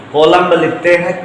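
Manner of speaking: ordinary talk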